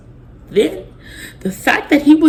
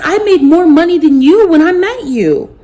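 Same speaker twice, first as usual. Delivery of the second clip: high, louder indignant cry; retold angry shout; 8 semitones higher, 6 dB louder than usual. To her husband, a high hurt shout. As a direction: throw it back at him, hurt and loud. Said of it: "I said".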